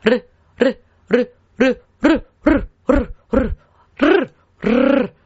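"ル" flicks pushed with air, growing into longer trills; flicked R with breath. Shown as a demonstration. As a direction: send breath through each "ル" until it starts to roll. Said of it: "息も一緒に送ります".

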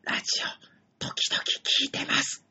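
whispered, embarrassed and slow; a shy fan's tiny whisper. Retold or imitated. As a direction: barely breathe it out like a secret. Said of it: "そんな小声で言わなくていいよ".

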